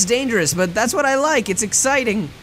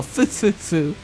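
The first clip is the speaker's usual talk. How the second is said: laughing; 3 semitones higher, slower than usual. Acted. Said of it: "[name]'s laughing for some reason".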